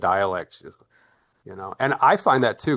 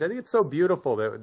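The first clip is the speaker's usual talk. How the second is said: usual speaking voice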